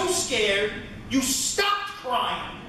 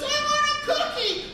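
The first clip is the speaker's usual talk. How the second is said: put-on high-pitched small child's wail; demanding little kid; 12 semitones higher than usual. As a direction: whine it much higher, like a little boy demanding. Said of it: "Somebody looks at you and goes".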